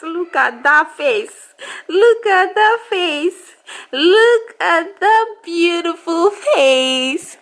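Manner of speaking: high, slow cooing. Real, not acted. Mother cooing over her baby daughter, high slow baby talk.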